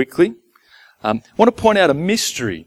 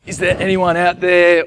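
higher, searching call; 6 semitones higher than usual. Acted. Man calling to whoever might be listening, raised and searching. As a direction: call into the unknown, pitched up. Contrasting impersonal call to God.

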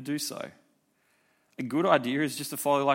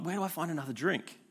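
hungover mutter; 3 semitones higher, 8 dB quieter, faster than usual. Retold or imitated. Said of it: "you wake up going".

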